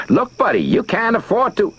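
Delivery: high whining neighbor. A narrator's reading. Neighbor whines a warning at Steve, high and nasal-free whine. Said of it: "Charlie's voice whined at him".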